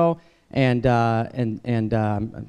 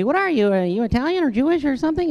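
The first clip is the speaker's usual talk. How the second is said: high curious questioning voice, pitched well up; a schoolkid asking what he is, high and curious; curious kid; 10 semitones higher than usual. Retold or imitated. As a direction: ask like a puzzled classmate. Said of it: "they would always ask me".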